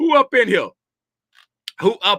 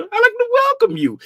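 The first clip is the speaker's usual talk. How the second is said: squeaky high greeting to returning visitors; much higher playful welcome. Acted. Welcoming viewers in raised voice.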